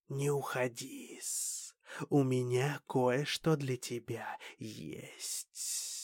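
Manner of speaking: soft, slow alien elder; slow quiet alien voice. Acted. Alien council head speaks softly.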